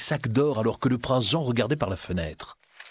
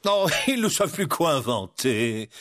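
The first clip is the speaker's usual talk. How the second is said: sheriff's amused aside; musing to himself; 2 semitones higher than usual. Acted. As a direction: remark it as if to yourself, lightly mocking. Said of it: "plus pour lui-même que pour le vieil homme".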